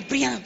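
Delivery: high, sudden slamming noise; imitated door slam. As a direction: imitate a door banging shut. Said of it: "Cerró la puerta". (portazo imitado, agudo y repentino)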